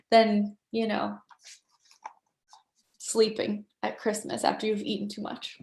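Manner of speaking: ordinary talk, nothing marked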